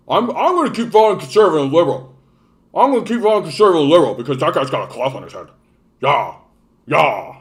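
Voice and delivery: hammed-up ignorant yokel impression; mock dim voter. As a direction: parody a stubborn, dim-witted voter. Mocking an ignorant voter's reasoning.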